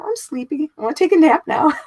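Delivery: put-on high, loud little-kid voice whining for a nap; sleepy little child. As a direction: play the tired small child who wants a nap. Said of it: "And I was like".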